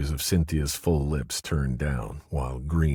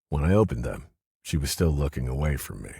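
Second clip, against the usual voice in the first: ordinary delivery, nothing marked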